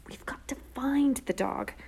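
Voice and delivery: slow, urgent whisper. A narrator's reading. Sister catching herself in the empty alley, whispering. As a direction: hiss it under the breath, drawn out. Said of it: "I repeated in a whisper".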